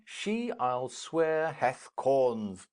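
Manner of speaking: ordinary talk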